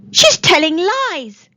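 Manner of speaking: loud, slow angry shout. Acted. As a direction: shout it in outrage. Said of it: "Tom shouted angrily".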